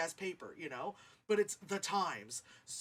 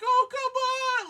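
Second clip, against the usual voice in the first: exasperated dad; 13 dB louder, slower than usual. Retold or imitated. Dad interrupts the kiss.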